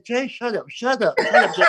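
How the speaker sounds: high, insistent mock pleading to shut up; acted urgent hushing. Driver to yelling friend by the police, acted hushing. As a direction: hush him urgently, playing it up.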